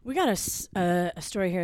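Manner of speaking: ordinary talk, nothing marked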